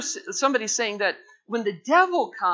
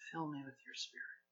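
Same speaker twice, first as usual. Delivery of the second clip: much softer, quicker, almost breathed; softer repeated plea; hushed prayer; 4 semitones lower, 19 dB quieter, faster than usual. Shown as a demonstration. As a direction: let the prayer drop to a hush. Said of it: "Would you just say that with me?".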